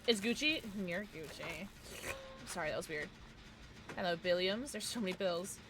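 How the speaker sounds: ordinary talk, nothing marked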